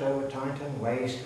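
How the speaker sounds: ordinary delivery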